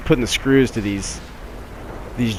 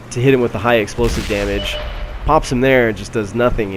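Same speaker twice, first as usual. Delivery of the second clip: usual speaking voice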